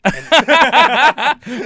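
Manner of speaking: big helpless laugh; loud high laugh. Real, not acted. Teased about being screaming drunk, bursts out laughing.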